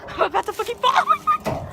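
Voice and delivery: loud, high panic shooting up to a shriek; panicked. Real, not acted. Climber about to fall off the fence, shrieking in panic. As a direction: shriek as you lose your balance.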